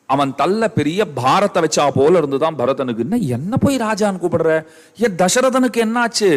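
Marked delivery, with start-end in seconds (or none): 3.0-6.3 higher-pitched, alarmed questioning, climbing as it goes; distraught outcry. Acted. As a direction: protest in dismay, pitch rising sharply. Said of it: "கதறான் பாருங்க அவன் உடனே". (பதறிக் கதறும் மகனின் குரல்)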